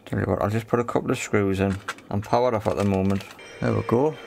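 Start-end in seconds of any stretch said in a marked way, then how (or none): none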